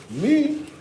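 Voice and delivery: high squeaky confession; comic high-pitched admission; self-mocking. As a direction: own up in a high silly squeak. Confessing forgetting bleach.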